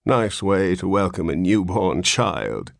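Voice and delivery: ordinary delivery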